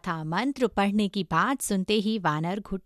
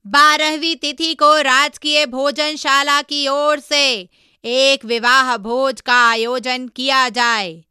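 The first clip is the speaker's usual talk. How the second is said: loud, raised, measured announcement; royal proclamation; 3 semitones higher, 8 dB louder than usual. Acted. King announcing a wedding feast to his court, royal proclamation. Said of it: "तो उसने ऐलान किया".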